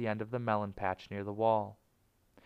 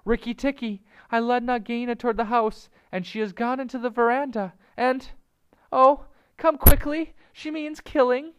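high, loud, frantic bird's alarm; screaming; 9 dB louder than usual. A narrator's reading. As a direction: scream for help in panic. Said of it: "Darzee's wife screaming".

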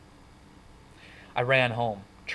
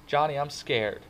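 higher-pitched confession; scared; 6 semitones higher than usual. A narrator's reading. Kid telling friend he is scared, upset. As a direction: admit fear after crying. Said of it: "My breath was coming in quivering sobs".

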